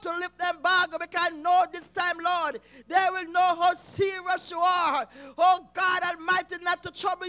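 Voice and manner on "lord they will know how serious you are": ordinary talk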